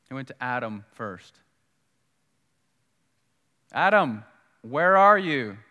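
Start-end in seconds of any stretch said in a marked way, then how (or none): 3.7-5.6 loud searching call; raised, commanding summons. Acted. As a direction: call out for someone hiding. God calling out to Adam.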